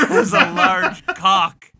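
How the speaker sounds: slow, laughing through the slip. Real, not acted. Game master laughing at his own slip to players. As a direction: crack up mid-line at your own slip. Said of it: "I wonder if I should keep that in".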